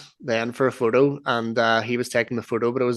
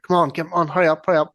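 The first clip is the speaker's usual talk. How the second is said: higher, quick impatient urging; urgent hurrying; 5 semitones higher, faster than usual. Retold or imitated. Sister rousing family for a photo, retold hurrying. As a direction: chivvy them along in quick repeats.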